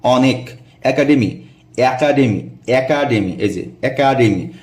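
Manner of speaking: একাডেমি said slowly, each syllable clear; pronunciation demo. Shown as a demonstration. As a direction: model একাডেমি carefully for the class. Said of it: "যেভাবে উচ্চারণ করতে হবে". (একাডেমি শব্দের ধীর, স্পষ্ট উচ্চারণ)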